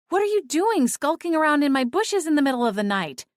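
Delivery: scolding. A narrator's reading. Cora scolding Clint for skulking, angry. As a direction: demand answers angrily.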